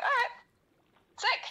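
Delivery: high-pitched, offhand quote; light, high put-on voice; voicing Gin. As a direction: pitch it way up, casually agreeing. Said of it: "And Gin's like".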